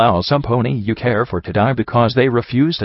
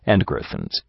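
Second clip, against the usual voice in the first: a lower, rougher-sounding male voice, offered as a suggestion; Calamity; 9 semitones lower, 5 dB quieter than usual. Acted. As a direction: drop into a gruff stallion voice. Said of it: "Suggested Calamity".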